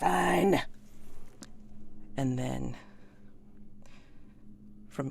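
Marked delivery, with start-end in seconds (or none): 0.0-0.6 sulky fine. Acted. Hired man answers sulkily.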